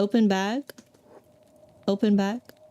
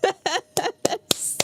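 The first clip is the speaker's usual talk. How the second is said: excited cry; 9 semitones higher than usual. Real, not acted. Dog finally eats.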